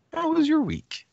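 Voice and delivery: squeaky high mock voice; high-pitched question. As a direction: ask it way up high. Host asks guest in high voice.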